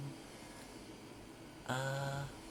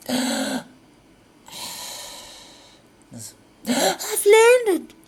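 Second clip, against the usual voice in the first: high, loud falsetto squeal; hammed-up gasp; 17 dB louder than usual. Woman gasping to him that she has landed. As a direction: gasp it out in a shrill mock lady voice. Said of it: "she gasped".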